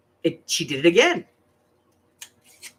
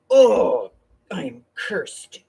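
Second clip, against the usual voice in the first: dramatic mock woe; 3 semitones higher, 5 dB louder, much slower than usual. Grandmother acting out being cursed by the newborn, mock woe. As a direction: gasp it, then sink into slow doom. Retold reaction to baby's claim.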